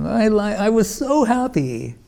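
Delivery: light up as you remember pure happiness; high, smiling and glowing; smiling happily. Retold or imitated. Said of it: "my, he's smiling".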